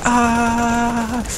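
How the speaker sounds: high drawn-out mock scream; marine scream. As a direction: let out a long high cartoon yell. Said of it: "He's just screaming constantly".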